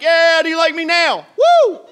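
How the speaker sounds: high, loud gloating holler; triumphant shout. Retold or imitated. Preacher as Elijah taunting the prophets of Baal, in a triumphant yell. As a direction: crow over the beaten rivals, ending in a whoop.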